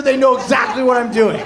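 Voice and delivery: ordinary delivery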